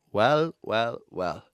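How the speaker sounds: drawn-out amused "well"; knowing "well well well". Real, not acted. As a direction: savour the surprise. Amused discovery.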